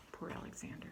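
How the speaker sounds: soft sympathetic whisper; whispered pity. Acted. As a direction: breathe it out with pity. Hushed pity.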